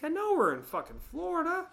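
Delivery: high, exasperated snap at the wife; annoyed claustrophobic outburst; exasperated retort. Retold or imitated. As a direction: bite back at her, fed up. Snapping back at wife.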